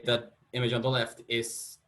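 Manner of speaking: ordinary talk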